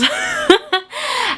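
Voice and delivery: squeaky high laugh; high giggle. Real, not acted. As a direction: burst into a giggle. Laughs at own joke.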